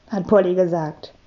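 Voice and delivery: plain reading voice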